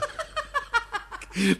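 a bright, high burst of laughter; girlish laugh. Acted. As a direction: laugh out at the silly maid from the tree. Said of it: "no puede contener la risa". (risa aguda y divertida)

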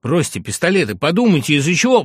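high, insistent appeal; urgent persuading. A narrator's reading. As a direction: talk hotheads out of a duel. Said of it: "продолжал уговаривать Пущин".

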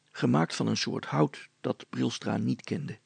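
ordinary delivery

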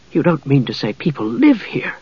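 softly incredulous; a hushed touch of shocked distaste; disgusted astonishment. A narrator's reading. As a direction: voice mild disbelief, keeping it soft. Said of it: "looking around in disgust and astonishment".

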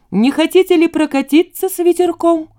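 high, loud, breezy come-on; cheerful braggart's invitation. A narrator's reading. Veselchak inviting the girls for a ride, cheerful braggart. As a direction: pitch it up like a cocky, cheerful tempter. Весельчак зазывает девчонок прокатиться.

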